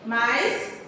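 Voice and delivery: usual speaking voice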